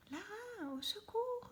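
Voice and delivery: put-on a little boy shrieking for his sister, pitched high; screaming for help. A narrator's reading. Rami caught in the growing tree, crying out to Lara in a high shriek. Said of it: "hurle Rami".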